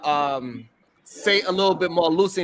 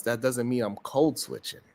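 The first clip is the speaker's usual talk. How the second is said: ordinary delivery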